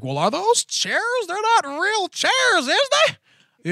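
high, slow mock skeptic voice; a scoffing fan who doubts it's real; doubting fan. Fans doubting the chairs, mocked in a high skeptical voice. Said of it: "to everybody out there who's like".

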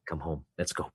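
soft whisper calling a son home; whispered invitation. Retold or imitated. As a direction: breathe it gently, barely voiced. Jesus voiced calling him home.

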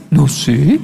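low start rising sheepishly; retold sheepish laugh. Son caught hiding a snack, imitated sheepish amusement. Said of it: "riéndose más dice".

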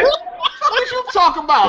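slow, high, laughing question; laughing disbelief. Real, not acted. Laughing at the co-host's question in disbelief. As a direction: chuckle in disbelief while asking. Scoffing at the apology demand.

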